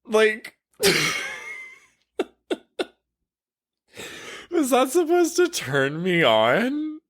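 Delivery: high, breaking into laughs of disbelief; stunned disbelief. Retold or imitated. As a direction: squeak out a baffled reaction between giggles. Her stunned reaction, retold.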